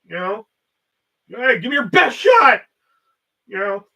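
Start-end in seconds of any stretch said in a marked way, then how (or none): 1.3-2.6 high, loud mock dare; defiant challenge. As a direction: shout it like a bold taunt. Acted defiant challenge to Coke.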